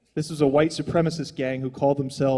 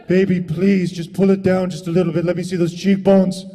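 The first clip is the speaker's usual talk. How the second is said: mock pleading lover; 4 dB louder than usual. Pleading to see a woman's face.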